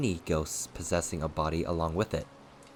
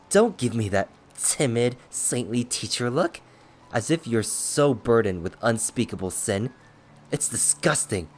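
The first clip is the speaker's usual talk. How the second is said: heated, raised-pitch disgust; scornful taunt; 4 semitones higher, 5 dB louder than usual. A narrator's reading. Trampled villain mocking his attacker's saintly look, scornful taunt. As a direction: sneer at the pious act. Same howled taunting speech goes on.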